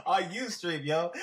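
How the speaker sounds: high, loud, laughing exclamation; retold amazed laughing outburst; laughing disbelief. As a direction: blurt it out laughing in shock. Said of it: "I was like".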